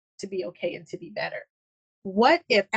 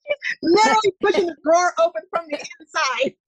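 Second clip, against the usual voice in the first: amused disbelief; 9 semitones higher, 8 dB louder, faster than usual. Real, not acted. Cat pushes drawer open.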